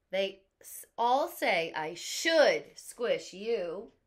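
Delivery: put-on loud, slow, torn kid. A narrator's reading. Teacher voicing the kid pressured by friends, loud and slow. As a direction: explain the peer pressure. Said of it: "Now the kid is speaking".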